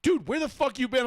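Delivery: high, loud, alarmed friend; retold alarmed shout; worried friend.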